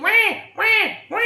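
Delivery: high mock baby cry; baby wail. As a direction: mimic the crying baby. Imitating Baby Mario cry.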